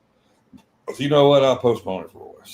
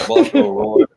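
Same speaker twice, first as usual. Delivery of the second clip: much higher, louder teasing call; calling out nickname; 13 semitones higher, 9 dB louder than usual. Real, not acted. Ribbing his absent buddy to the co-host, a loud high teasing call. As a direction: belt out the nickname as a joke.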